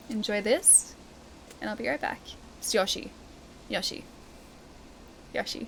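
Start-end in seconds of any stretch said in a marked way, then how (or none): 2.7-5.7 Yoshi repeated low, with an O first. Shown as a demonstration. Last word to chat before the break, Yoshi with O. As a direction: practise Yoshi quietly, keeping the O. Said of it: "I'm saying it with an O".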